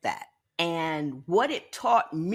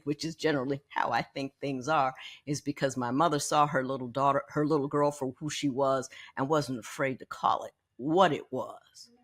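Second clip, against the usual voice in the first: normal talking voice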